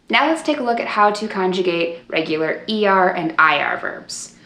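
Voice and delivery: ordinary talk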